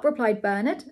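ordinary delivery

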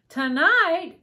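high, loud cry of disbelief; dismayed outburst. A narrator's reading. Ellie hears the mice leave tonight, dismayed outburst. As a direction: blurt it out high, close to tears.

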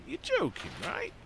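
high-pitched disbelief; incredulous. Real, not acted. Player balking at waiting till a hundred percent, incredulous.

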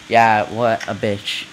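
ordinary talk

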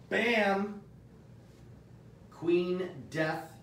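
reveal bam. Real, not acted. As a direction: hit it like a drumroll. Dramatic reveal.

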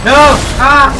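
high, loud yell; panicked scream. Real, not acted. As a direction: scream as you're hit. Screaming at game.

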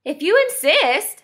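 high-pitched, obliging insect; storybook mantis, willing to fight; praying mantis. A narrator's reading. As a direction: accept the challenge in a high little voice. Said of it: "said the praying mantis".

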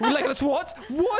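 high laughing bafflement; baffled shock. Real, not acted. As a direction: squeak out in confused shock. Confused reaction.

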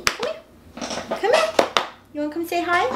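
high sing-song call to a pet; high dog-calling voice. Real, not acted. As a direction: coax the dog over, voice way up.

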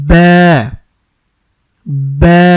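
ব্যা held one alif length, loud from the lips; stretched ba. Shown as a demonstration. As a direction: draw out ব্যা for one count. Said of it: "এক আলিফ টানতে হবে।". (এক আলিফ টেনে ব্যা)

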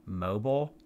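"Mobile" said MOH-bul, unstressed ending. Real, not acted. Host checks the word for crib toy, "Mobile" as MOH-bul. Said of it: "It's pronounced mobile".

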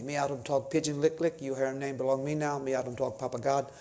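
lightly voiced Papua New Guinea pidgin; showing off his Tok Pisin pidgin. Shown as a demonstration. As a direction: rattle off a bit of pidgin as a demo. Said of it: "So I have that language down".

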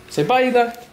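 high coaxing pet voice; talking to a pet. Real, not acted. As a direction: sweet-talk the dog.

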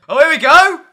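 high, loud burst of anticipation; loud hyped-up cheer; excited shout. Real, not acted. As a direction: shout it as the big reveal lands. New image appears, excited announcement.

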